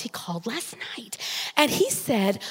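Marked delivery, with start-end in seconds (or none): 0.0-1.2 hushed whisper. Retold or imitated. Boss whispering news of the call to Christy. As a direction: whisper it like a secret. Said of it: "she just talked like this".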